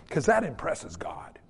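usual speaking voice

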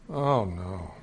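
low, hushed, sinking voice; deep, quiet mock horror; retold dismay. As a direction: let the dread sink in, comically. Dismay at nobody washing hands.

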